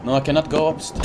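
ordinary delivery

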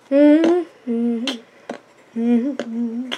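normal talking voice

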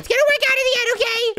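high squeaky repeat; squeaky insistence. Acted. Repeats after Caine mocks build.

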